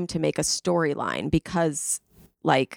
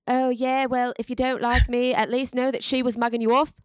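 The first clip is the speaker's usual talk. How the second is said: gossipy British islander voice, pitched high; British Love Island accent; 6 semitones higher than usual. Retold or imitated. Host imitating a Love Island contestant, British accent. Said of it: "killing the accent".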